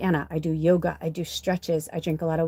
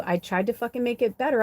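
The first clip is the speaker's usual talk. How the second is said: ordinary delivery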